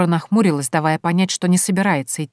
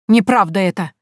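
louder outburst, pitch up; a hot, louder denial; flaring up; 3 semitones higher, 6 dB louder than usual. A narrator's reading. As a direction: flare up in denial. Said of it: "вскинулась Степанида Пантелеймоновна".

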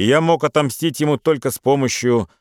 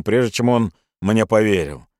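plain reading voice

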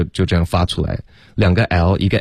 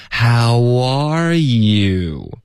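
stretched greeting with no gaps, pitch higher; very slow, linked demo; 4 semitones higher, much slower than usual. Shown as a demonstration. Teacher proving slow speech still links, very slow connected greeting. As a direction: draw every word out, gliding into the next. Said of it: "还是非常连贯的".

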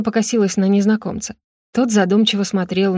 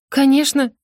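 higher-pitched, breezy yes; easy lie; 6 semitones higher than usual. A narrator's reading. Rosa lying to the king, easy and light. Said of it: "легко солгала Роза".

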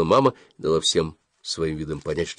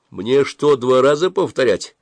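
louder, firm warning; decisive, firm; 6 semitones higher, 6 dB louder, slower than usual. A narrator's reading. Officer firmly warning the boys, louder. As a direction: put your foot down. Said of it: "спросил офицер решительно".

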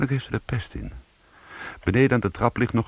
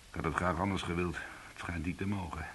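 much softer, sinking voice; resigned; 2 semitones lower, 13 dB quieter than usual. Acted. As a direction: give up the argument under your breath. Fired man gives up arguing.

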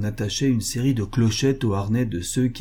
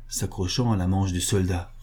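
plain reading voice

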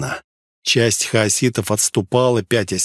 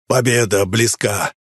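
lightly voiced proud commander; confident warlord. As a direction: declare victory with assurance.

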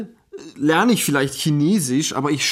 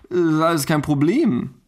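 usual speaking voice

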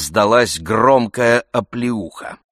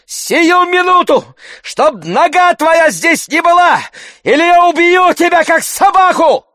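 loud, very high, furious threat; raging shout; 7 dB louder than usual. A narrator's reading. Oblomov threatening to kill Tarantyev, shouting in rage. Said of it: "трясясь от ярости".

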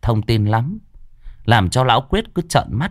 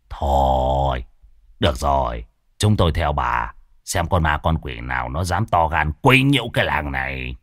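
grudging; 7 semitones lower than usual. Acted. Reluctantly agreeing.

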